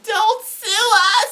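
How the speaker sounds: very high, drawn-out scream; imitated mom's scream. Son imitating his mom running out, high drawn-out scream. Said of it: "my mom ran out screaming".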